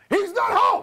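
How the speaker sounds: very high shouted brush-off; shrieked imitation of grandma on the phone; Greek grandma. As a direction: yell it as a fierce grandmother. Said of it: "grandma would say".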